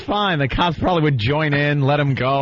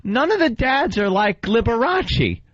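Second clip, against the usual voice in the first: put-on kid asking grandma; 8 semitones higher than usual. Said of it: "and watch and go".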